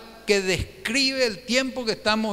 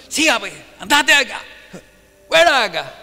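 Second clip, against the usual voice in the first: a louder, rough-voiced shove out the door; angry chasing off; 6 dB louder than usual. Shimei chasing the king off the road, angry driving away. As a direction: drive him away with the words. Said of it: "le corrió encima".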